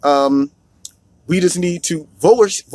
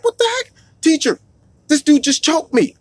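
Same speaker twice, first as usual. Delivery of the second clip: pitched way up, upset and alarmed; kid's high alarmed voice; 10 semitones higher than usual. Retold or imitated. Choked boy tells the teacher, high and alarmed. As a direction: squeak it out like a shocked schoolkid. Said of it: "the kid's like".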